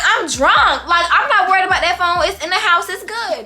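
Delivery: high, lightly hammed-up drunken protest; re-enacted drunk defensiveness. Drunk girl brushes off friends' worry.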